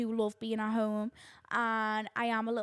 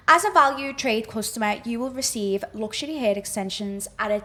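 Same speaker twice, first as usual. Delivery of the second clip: louder, upbeat ad-read voice; sponsor ad read; 11 dB louder than usual. Acted. Reading the hair extensions sponsor message, a louder upbeat ad read. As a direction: sell it like a sponsored spot.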